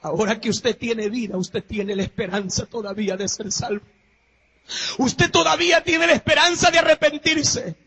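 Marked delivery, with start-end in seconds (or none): 5.0-7.8 shouted, higher, slowed preaching; preacher shouting about Jesus to the congregation; preaching at full force. Acted. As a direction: thunder it from the pulpit. Rising exhortation.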